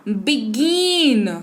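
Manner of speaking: बिगिन said very slowly with a clear ई sound; slow demo word. Shown as a demonstration. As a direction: stretch out बिगिन to show the ee. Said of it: "लेकिन हम लोग पढ़ रहे हैं क्या?".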